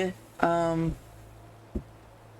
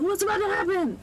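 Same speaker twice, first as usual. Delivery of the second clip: tense wondering; 8 semitones higher than usual. Retold or imitated. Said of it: "and you're like".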